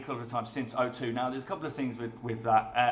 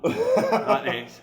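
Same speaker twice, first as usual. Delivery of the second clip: laughing; 7 dB louder than usual. Real, not acted. Laughing at the memory.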